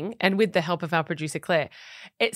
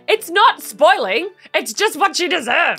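emphatic; 8 semitones higher, 7 dB louder than usual. Retold or imitated. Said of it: "'Cause I'm like".

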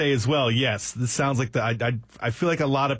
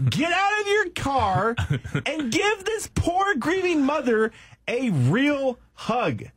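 high, slow mock outrage; indignant demand; 11 semitones higher, much slower than usual. Co-host scolding the absent driver, indignant demand. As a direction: scold him slowly, pitched way up.

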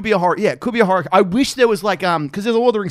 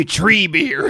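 Tree Beard; 4 semitones higher than usual. Acted. Fan mocking the walking tree's name, slow Tree Beard voice. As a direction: draw it out as an ancient tree creature. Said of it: "it's like, they call me".

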